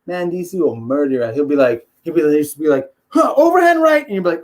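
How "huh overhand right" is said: high, loud lunge with a punch; drunk brawler's shout. Acted. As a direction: yell it as you swing wildly. Acts out drunk fighter's wild punch.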